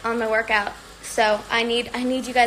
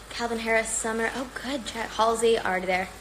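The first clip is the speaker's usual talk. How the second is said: ordinary talk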